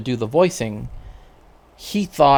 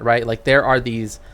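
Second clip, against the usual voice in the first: normal talking voice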